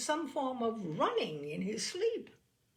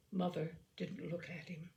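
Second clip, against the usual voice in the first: plain reading voice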